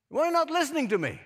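high, fast mock-indignant demand; condescending complaint. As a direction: imitate a petulant complaint to God. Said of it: "It was very condescending".

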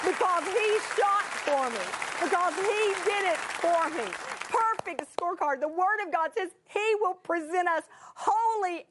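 high, fervent preaching. Acted. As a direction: build the congregation up. Preaching full force.

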